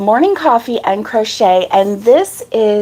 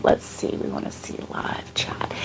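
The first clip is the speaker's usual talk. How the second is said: whispering; 14 dB quieter than usual. Real, not acted. Reading chat to herself, whisper.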